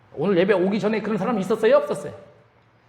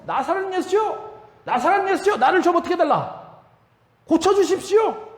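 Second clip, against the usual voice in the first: Bartimaeus shouting louder; 11 semitones higher than usual. Retold or imitated. Blind beggar cries out even harder to Jesus, high pleading shout. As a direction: re-enact an insistent shout, pitched way up. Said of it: "더 크게 소리를 지르죠".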